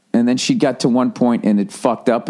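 ordinary talk, nothing marked

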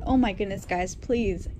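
normal talking voice

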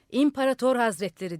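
shouted address. A narrator's reading. Old man addressing the madman on the roof, shouting. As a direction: hail him loudly from below. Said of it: "diye bağırdı".

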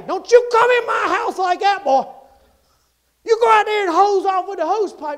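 high, loud telling-off; scolding mama. Acted. Mama to her muddy boy, scolding loudly. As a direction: holler it like a mother at the door.

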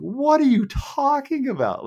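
high, slow disbelieving squeak; a sudden high squeak of disbelief at his own calf; incredulous squeak. Retold or imitated. Runner asking his cramping calf, high incredulous squeak.

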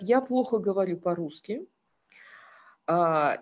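ordinary talk, nothing marked

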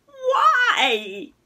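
put-on high, loud whining cry of a boy; whiny repeated why. A narrator's reading. Boy protesting to his mother, whining loudly. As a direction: complain loudly, dragging the word out. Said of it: "he kept repeating, a bit unpleasantly".

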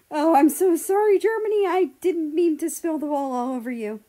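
loud, high, panicked sorry; flustered apology. A narrator's reading. America apologizes to Germany after the spill, loud and flustered. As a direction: blurt out the apology in a rush.

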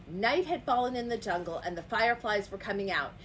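usual speaking voice

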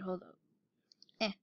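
ordinary talk, nothing marked

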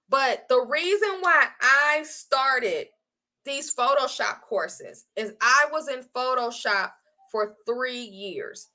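normal talking voice

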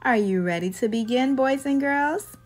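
ordinary delivery

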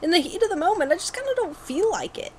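high-pitched excuse to viewers; mock apology. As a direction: plead your excuse in a high voice. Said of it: "I'm, I'm like".

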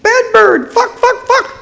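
high, loud bird squawk; cursing bird. A narrator's reading. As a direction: squawk it like a foul-mouthed parrot. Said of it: "cursing fluidly".

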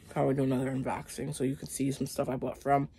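normal talking voice

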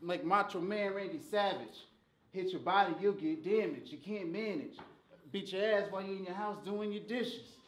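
ordinary talk, nothing marked

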